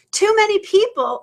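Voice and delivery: pressing the point with real passion; passionate. Real, not acted. Coach to her live audience, passionately. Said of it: "I get very passionate about this".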